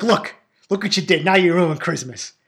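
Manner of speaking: scolding mother. Retold or imitated. Mother blaming son for the wrecked tree, scolding. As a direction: lay the blame like an upset mom.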